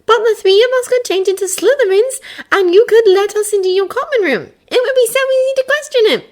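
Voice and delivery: put-on high girl voice, reasoning; Hermione voice. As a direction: lay out the logic.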